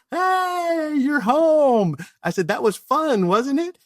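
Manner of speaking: very high, bright mock-cheerful hello; hammed-up sing-song welcome home; cheery teasing greeting. Teasing husband greets wife.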